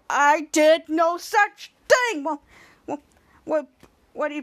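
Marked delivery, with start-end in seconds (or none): 0.1-2.2 slow denial. Retold or imitated. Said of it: "and said".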